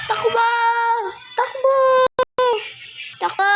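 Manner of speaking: high urgent calls to run; panicked yelling. Real, not acted. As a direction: shout at everyone to run.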